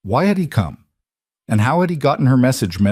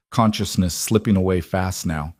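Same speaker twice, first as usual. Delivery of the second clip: ordinary delivery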